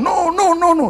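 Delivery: put-on a small girl fending off a tease; child protesting. Little sister protesting her brother's teasing, high and loud.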